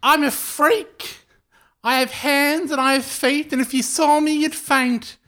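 high, slow eerie creature voice; creepy mongoose voice. Acted. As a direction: spook them from inside the walls. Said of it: "that sound quite similar to that, but not that".

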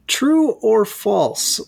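slow game show announce; drawn-out quiz host call. Pretend.

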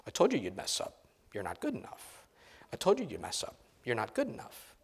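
a soft, relentless taunt, over and over; quiet repeated jabs, wearing them down; nagging voice. Acted. Pastor voicing the nagging critic in a spouse's head, softly.

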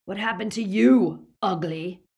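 villain's mocking question; taunting. A narrator's reading. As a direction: taunt the captive loudly. Greyback taunts his captive.

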